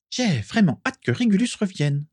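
put-on high, lilting ghost-girl voice; singsong voice. A narrator's reading. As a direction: sing the wish out in a high teasing lilt. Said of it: "dit-elle de sa voix chantante". (voix chantante et aiguë)

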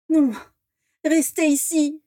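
high, pleading cry to stay. Acted. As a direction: beg the dragons not to leave. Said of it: "supplia Vera".

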